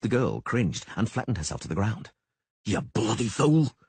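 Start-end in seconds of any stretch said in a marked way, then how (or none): 2.7-3.8 hissed rebuke. A narrator's reading. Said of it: "the Witcher hissed".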